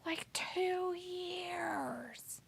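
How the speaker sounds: very slow, hushed whisper; drawn-out whisper. Pretend. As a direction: whisper it, stretching out each word. Teasing repeats of a phrase.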